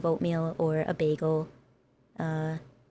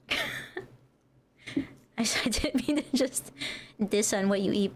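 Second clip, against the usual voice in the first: ordinary talk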